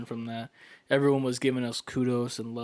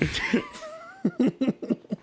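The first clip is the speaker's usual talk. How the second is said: high laugh. Real, not acted. Cracking up at the bit.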